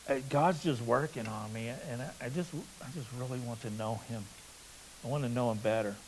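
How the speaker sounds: soft and timid, trailing away; shy quiet voice. Retold or imitated. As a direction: say it shyly, barely audible. Shy girl voiced to pastor.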